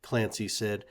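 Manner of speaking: plain reading voice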